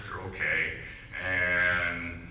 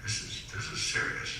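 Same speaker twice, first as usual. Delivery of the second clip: soft, grave retold remark; hushed seriousness; 8 dB quieter than usual. Speaker recalling his reaction to the coach's warning, hushed and serious. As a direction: drop the voice right down, in earnest. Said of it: "he was like".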